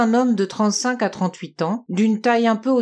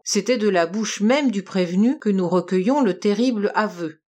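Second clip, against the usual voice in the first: ordinary delivery, nothing marked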